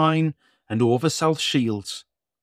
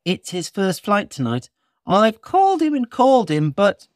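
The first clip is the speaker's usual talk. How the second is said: christmas elf; 9 semitones higher, 4 dB louder than usual. Acted. Elf speaks.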